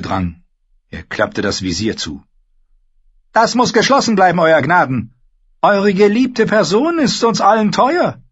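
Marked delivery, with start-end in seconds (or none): 3.3-8.2 high, loud stern politeness; firm courtly order. Acted. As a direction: command him with mock courtesy. Tyrion addresses the king. (bestimmt-höflicher Befehl)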